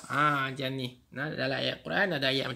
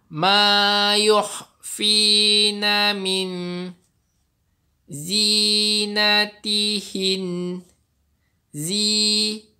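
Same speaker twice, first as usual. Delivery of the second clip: slow, drawn-out chanted recitation; Quran recitation; 6 semitones higher, 9 dB louder, much slower than usual. Shown as a demonstration. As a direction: recite it slowly in tajwid style. Repeats verse.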